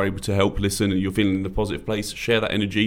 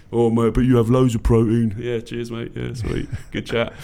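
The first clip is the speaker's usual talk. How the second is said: pub bloke banter; 2 semitones higher than usual. Retold or imitated. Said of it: "chat complete crap to you".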